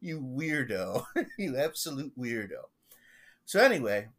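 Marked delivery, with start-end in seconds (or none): 0.0-2.6 laughing, incredulous scolding; mock exasperation. Pastor retelling his reply to eccentric parishioner, mock exasperation. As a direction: scold affectionately through breaks of laughter.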